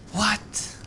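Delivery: high rising surprised what. Real, not acted. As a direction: ask it in high disbelief. Surprised reaction to a find.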